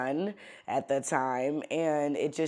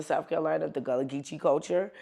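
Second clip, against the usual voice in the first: normal talking voice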